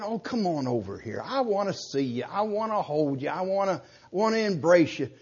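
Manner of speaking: soft, coaxing welcome, imitating a mild plea; gentle invitation. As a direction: invite them over tenderly, as a mock plea. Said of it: "Is the Lord saying".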